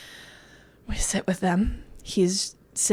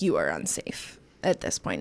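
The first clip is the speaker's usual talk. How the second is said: much lower, softer warning voice; inner body voice; 8 dB quieter than usual. Retold or imitated. Her body telling her she is unsafe. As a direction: voice her body's low inner alarm.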